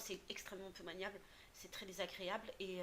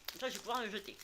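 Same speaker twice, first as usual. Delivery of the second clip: chewing while talking; faster than usual. Real, not acted. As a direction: mouth full of candy. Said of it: "ça se fait pas de parler à bouche pleine".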